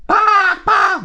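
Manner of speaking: high loud bah squawks; frustrated crow squawk. Acted. Crow cries.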